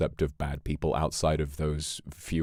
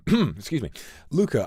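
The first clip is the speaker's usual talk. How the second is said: normal talking voice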